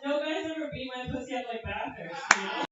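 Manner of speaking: ordinary talk, nothing marked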